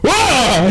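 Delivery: high startled "wah"; startled yelp. Acted. Captain finds a gnome clinging to his body, startled yelp. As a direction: yelp in surprise. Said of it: "just goes".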